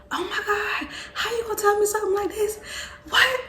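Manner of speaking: high squealing shock, near crying; excited shriek. Retold or imitated. Cousin Diamond shrieking at the pregnancy news, high and excited. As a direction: squeal it like she can't believe it.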